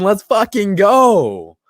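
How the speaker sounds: slow, loud drawn-out hype yell; hype shout. Real, not acted. Streamer hyping his chat that Watson is still a Texan, slow loud cheer. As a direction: stretch it out like a victory cheer.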